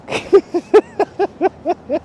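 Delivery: high, rapid chuckle; a string of high-pitched giggles. Real, not acted. Streamer amused by his own bad singing, giggling.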